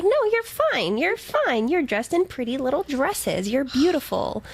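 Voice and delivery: very high, cooing fake reassurance; mock placating. Co-host imitates them placating the bride, high mock reassurance. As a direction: coo it high, brushing every worry aside.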